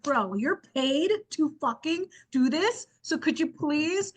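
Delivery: slow, high-pitched mock begging; exasperated plea. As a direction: plead in acted disbelief, stretching the words. Imagined plea to obstinate official.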